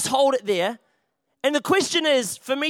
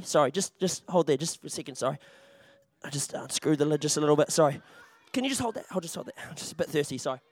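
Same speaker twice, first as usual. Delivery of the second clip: quiet aside; 9 semitones lower, 7 dB quieter than usual. Real, not acted. Muttered asides while sneaking drink.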